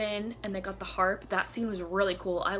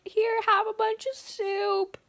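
doting caretaker; 5 semitones higher, 4 dB louder than usual. Retold or imitated. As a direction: fuss over her like a nurse. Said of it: "He's like".

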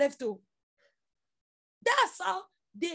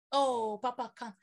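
normal talking voice

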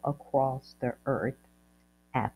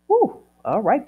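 relieved whew; 7 semitones higher, 7 dB louder than usual. Real, not acted. Relief after long name list.

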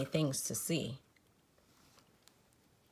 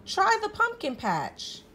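much higher, louder helpful tip; the cow advising; 5 dB louder than usual. A narrator's reading. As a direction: brightly send Spook onward. Cow's advice voiced in story.

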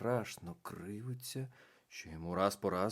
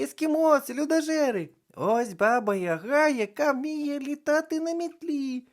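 much higher, louder excited call; 9 dB louder than usual. A narrator's reading. Дівчинка скликає дітей на майдані, гучний високий поклик. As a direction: summon the whole square. Calling the crowd over.

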